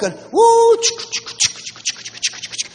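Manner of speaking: loud woo whistle then breathy chugging; steam train noise. Retold or imitated. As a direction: imitate an old wooden steam train. Imitating an old steam train.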